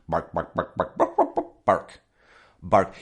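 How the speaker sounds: clipped repeated "bark" as a dog; playful yapping bursts; imitated dog barking. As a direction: mimic the neighbor's dog with the word bark.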